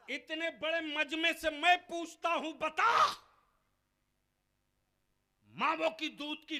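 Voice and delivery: loud, high, fiery challenge to the crowd; preaching at full force. Real, not acted. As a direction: hurl it at the whole gathering. (पूरे जोश से ऊँची आवाज़ में ललकारती तक़रीर)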